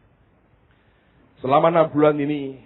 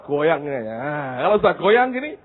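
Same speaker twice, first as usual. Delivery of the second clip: ordinary talk, nothing marked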